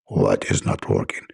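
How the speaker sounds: a cranky angel snapping down the line; gruff angel Jibreel. Acted. Angel Jibreel answering caller, gruff and annoyed.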